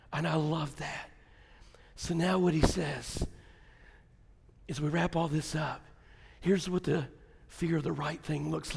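soft, low, intimate sermon voice; hushed preaching. Acted. Pastor wrapping up for the congregation, softly and low. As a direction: draw the congregation in close and soft.

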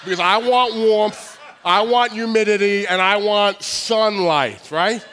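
loud, drawn-out declaration of wants; emphatic demand. Real, not acted. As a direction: belt out each want slowly and forcefully. Mock rant demanding warm weather.

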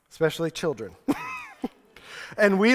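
normal talking voice

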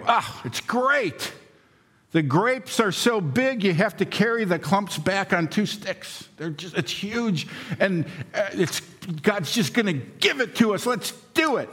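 high, eager and thrilled, voice lifted well up; excited spies. Retold or imitated. Caleb and Joshua urging Israel to take the land, high and excited. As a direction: pump the crowd up like a spy who saw giant grapes. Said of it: "And they go".

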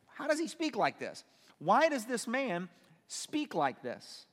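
high-pitched disbelief, slowing into emphasis; incredulous questioning. Acted. Scribes objecting to Jesus' words, incredulous and emphatic. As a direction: question it in mock astonishment, drawing out the last words.

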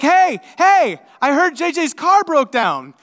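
a friend calling out the news, excited; excited greeting. Retold or imitated. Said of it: "so she's happy".

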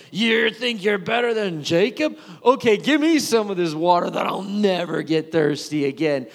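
mock his offer, sneering; sarcastic. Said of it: "it was a little sarcastic".

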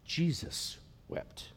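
slow, soft whisper; whispered compassion. Shown as a demonstration. Pastor whispers to congregation, slow and compassionate. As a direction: breathe the words with tender compassion. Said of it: "I want you to hear the compassion".

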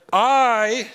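a loud, booming God's I. Acted. Preacher voicing God's first word, booming. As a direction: proclaim it as God himself, not as yourself. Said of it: "not me, God this time".

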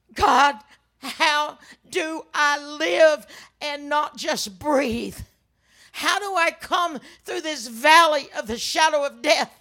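loud, slow, emphatic cry to God; anguished prayer. Retold or imitated. Widow asks God how to live, anguished cry. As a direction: lean hard on each word, pleading.

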